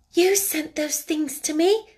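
high, slow, moved and joyful; joyful, emotional. Acted. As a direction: ask it with glad disbelief, drawn out. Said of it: "joyful, emotional little voice".

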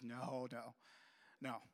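low, soft retold no; quiet refusal. Mother rejecting son's quick fix, quietly. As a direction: turn it down in a low hush.

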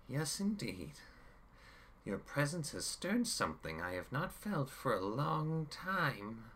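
high, gloating villain voice with a grin; grinning villain. A narrator's reading. Chaos grins at Jim, high and gloating. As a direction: purr it out with a grin. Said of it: "Chaos added with a grin".

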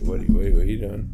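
loud, fast, startled questioning. Real, not acted. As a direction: blurt it out in alarm. Startled at someone moving things.

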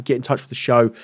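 usual speaking voice

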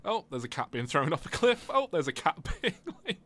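high, laughing mock horror; laughing in disbelief. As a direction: laugh through the shocked commentary. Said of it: "and it was like".

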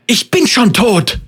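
high, loud despairing shriek. Acted. Senna shrieks at the voice that she is already dead, high and loud. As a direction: scream it out in anguish. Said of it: "kreischte sie".

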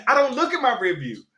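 high-pitched protest through laughter; laughing indignation. Retold or imitated. Indignant retort continues, with laughter.

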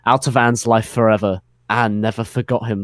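usual speaking voice

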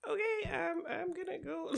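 very high, soft, timid exit; small, high-pitched imitation of awkward retreat; awkward Miss Froy. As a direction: excuse yourself awkwardly in a tiny high voice. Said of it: "Miss Froy's just like".

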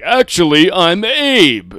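loud, high, hammed-up declaration; shouting at his mom that he's Abe; loud mock insistence. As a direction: proclaim it like a madman. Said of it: "you're like".

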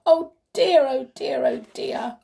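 high wail that fades as it goes; crying out in dismay. Acted. King afraid for his head, crying out in dismay. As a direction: fret in a high voice, loud then softening. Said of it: "cried the king".

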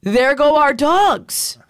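put-on high, slow, drawn-out kid quote; seven-year-old son. Son stuck in the snow tells parent, high slow mock child voice. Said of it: "He goes".

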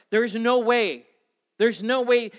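exclaiming in a panic that it can't work; loud despairing cry, voice jumping up; panicked. Retold or imitated. Young husband panicking to his wife about money, loud panicked cry.

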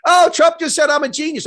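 high, loud mock glee; mock delight. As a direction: yelp it out like a gleeful villain. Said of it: "Putin's in the Kremlin going".

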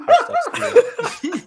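squealed through a laugh, slow and high; laughing it out high and drawn. Real, not acted. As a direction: crack up while saying it. Laughing mid-line.

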